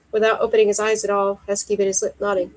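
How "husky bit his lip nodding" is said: ordinary delivery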